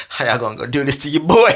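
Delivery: loud, high, quick comic complaint; mock protest. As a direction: plead your case in mock outrage.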